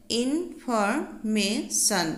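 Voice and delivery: Information slowly split into four syllables; teacher's slow syllable count on information. Shown as a demonstration. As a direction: break information into in, for, may, shun with small gaps. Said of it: "চাৰিটা syllable আছে".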